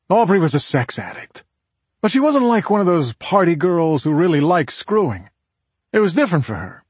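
pressing, confiding explanation; emphatic husband. Acted. Husband explains sister-in-law's behavior.

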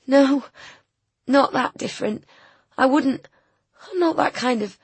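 high, upset woman's protest; close to tears. A narrator's reading. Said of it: "close to tears".

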